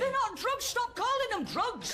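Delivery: angry shout. Acted. Furious row at passport control.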